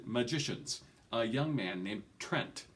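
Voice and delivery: usual speaking voice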